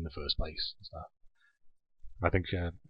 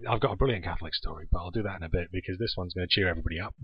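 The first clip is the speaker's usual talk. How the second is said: ordinary delivery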